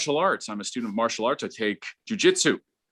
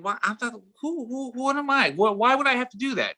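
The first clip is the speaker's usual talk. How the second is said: mock disbelief; 10 semitones higher than usual. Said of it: "I'm thinking".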